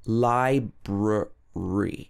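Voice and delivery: Library drawn out slowly, three clear syllables. Shown as a demonstration. Teacher modelling three syllables, slow and clear. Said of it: "got three syllables".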